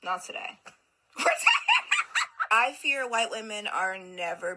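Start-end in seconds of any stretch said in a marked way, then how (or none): 1.2-2.5 a high, loud burst of laughter; loud incredulous laugh; shrieking laugh. Real, not acted. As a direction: cackle in disbelief. Reacting to insulting clip.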